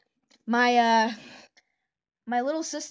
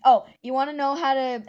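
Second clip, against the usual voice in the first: ordinary talk, nothing marked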